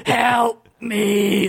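high, stretched-out plea for rescue; pleading cry. A narrator's reading. Adventurer being digested pleads with skeleton, crying out. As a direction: draw out a hammed-up call for help.

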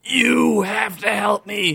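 high, slow, drawn-out plea; horrified cry. A narrator's reading. Adventurer inside the cube begs skeleton, crying out in horror. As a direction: stretch the plea out in acted horror. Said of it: "he cried out in horror".

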